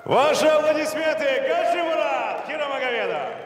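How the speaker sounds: high, loud applause call. Real, not acted. Комментатор просит аплодисментов. (громкий призыв к аплодисментам)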